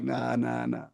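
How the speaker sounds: soft, low dismissal; backing down. Retold or imitated. Talking himself out of a fight, backing down. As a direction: wave it off quietly, low.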